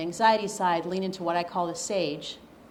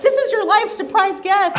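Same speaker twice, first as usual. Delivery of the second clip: high, loud, laughing game-show voice; laughing mock announcer; 13 semitones higher, 12 dB louder than usual. Speaker jokingly announcing her intern like a TV host, laughing. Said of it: "This is like".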